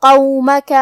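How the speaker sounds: both vowels even, recited; tajweed recitation. Shown as a demonstration. Teacher demonstrating even vowels, recited. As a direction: recite with equal short vowels. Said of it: "برابر سے ادا کرنا ہے".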